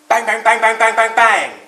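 very loud banging beats; loud pecking bangs. Acted. Woodpecker pecking her nest hole, loud banging. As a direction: hammer out each bang like a beak on wood.

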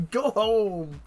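high, slow, drawn-out "get out"; playful shooing. Pretend. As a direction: shoo the audience out, grinning. Streamer shooing viewers away.

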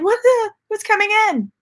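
high-pitched startled voice, played up; mock alarm. Telling viewers about the door opening at night, mock alarm. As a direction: act out a spooked reaction, pitched high.

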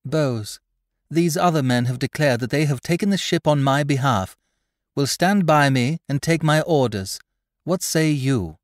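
blunt. A narrator's reading. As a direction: put it bluntly and demand a reply. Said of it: "Polly Langton addressed him bluntly".

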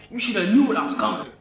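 quick gruff mock rapper voice, a bit rushed. Reacting to a song, mimicking the rapper's flow badly. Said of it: "that was a very bad accent".

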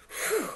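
high, airy exhale; relieved whew. Acted. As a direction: blow out relief after a close call. Relief after escaping the eagle.

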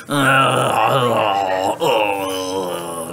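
long, low, drawn-out groans; demonstrated groan. Shown as a demonstration. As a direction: groan as if showing children how. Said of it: "Do you know how to groan?".

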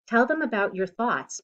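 usual speaking voice

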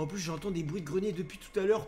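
ordinary talk, nothing marked

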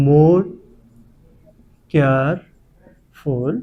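syllable by syllable. Shown as a demonstration. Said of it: "এটা কিন্তু দুই syllable বিশিষ্ট". (more careful ধীরে থেমে থেমে ভেঙে বলা)

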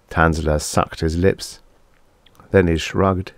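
ordinary delivery, nothing marked

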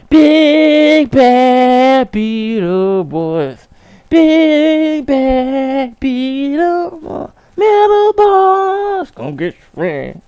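high, slow, drawn-out villain chant; cartoon villain voice. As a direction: ham it up like a TV villain. Reciting childhood show lines.

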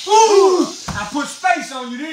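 high, loud whoop of victory; winning the race, shouting; triumphant shout. Real, not acted. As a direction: crow at your son after the win. Wins the race.

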